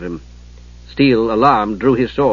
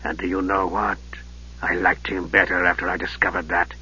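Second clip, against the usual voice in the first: low, soft, sinister mage; Raistlin's voice; 6 semitones lower, 9 dB quieter than usual. Acted. Raistlin greeting his nephew, low and softly sinister. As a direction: hush it like a frail dark wizard.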